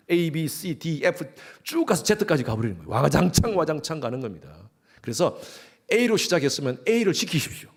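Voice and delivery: ordinary delivery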